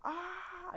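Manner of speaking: high yelp of pain; pained scream. Retold or imitated. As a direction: cry out as the fire hits. Deku burned by Dobby.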